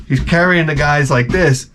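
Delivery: normal talking voice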